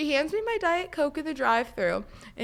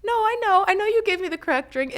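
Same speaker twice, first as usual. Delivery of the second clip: flustered insisting; 7 semitones higher, 4 dB louder than usual. Retold or imitated. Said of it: "And I was like".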